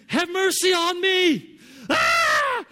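slow, high, very loud pleading shout; Loke's loud prayer. Retold or imitated. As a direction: beg for mercy at full volume, stretching it out. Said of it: "when he prays, he's really loud".

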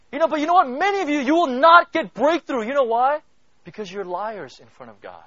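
loud, high preaching voice that keeps building; full-force preaching. Acted. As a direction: preach it out to the whole congregation.

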